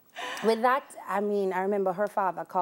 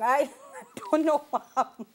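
laughing; 6 semitones higher than usual. Real, not acted. Laughing at own story.

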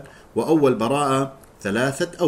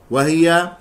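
ordinary delivery

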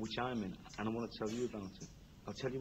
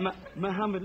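raised, higher call of the name "Muhammad"; calling louder; 8 semitones higher, 6 dB louder than usual. Real, not acted. Guest calling his host louder to get his attention.

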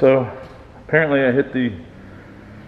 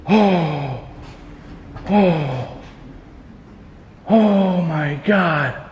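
gasped, long falling exclamations; real shock; 3 semitones higher, much slower than usual. Real, not acted. Ghost hunter frozen after a scare, moaning in shock. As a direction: draw each word out, reeling from a scare.

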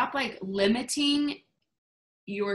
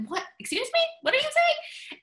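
very high-pitched, exaggerated disbelief; mock incredulous. Leader reacting to a complaint about a no, mock incredulous. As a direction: squeal it like you can't believe your ears.

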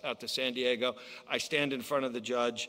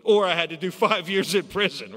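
laughing; 5 semitones higher, 9 dB louder than usual. Real, not acted. Laughs at the prison alternative.

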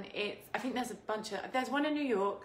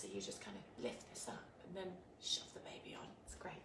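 whisper; 3 semitones lower, 15 dB quieter than usual. Real, not acted. Explaining nursing dress to viewers.

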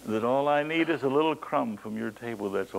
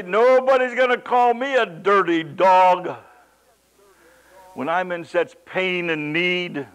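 offended retort; 6 semitones higher, 8 dB louder than usual. Retold or imitated. Imagined offended reply.

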